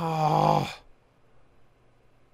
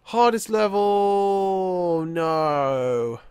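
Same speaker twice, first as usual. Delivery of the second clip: high, very slow wail sinking down; drawn-out dismay; 5 semitones higher, 3 dB louder, much slower than usual. Real, not acted. Gamer facing the hardest level, drawn-out dismay. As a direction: stretch the vowels in mock dismay.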